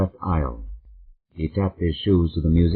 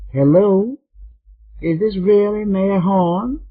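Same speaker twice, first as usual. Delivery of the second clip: much higher, slow sweet Southern lady voice; sweet drawl; 13 semitones higher, 3 dB louder, slower than usual. Acted. Fifty-seven-year-old caller asking the mayor, slow high sweet drawl. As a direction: draw it out high and sugary. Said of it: "Betsy Ribbon asked in her sweet drawl".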